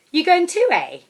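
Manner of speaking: put-on high, loud, brassy holiday girl; brash loud woman. Airport stranger hailing the narrator, high and loud. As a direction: belt it out like a brash party girl.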